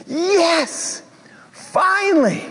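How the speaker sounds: high, cried-out hooray; mock cheer. Preacher playing the hopeful listener, high excited cheer. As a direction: celebrate like the crowd finally got its wish.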